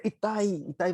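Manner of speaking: put-on boy yelps in pain at the brick; sudden pain cry. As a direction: act the hurt boy crying इताई. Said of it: "तो ये कहेगा".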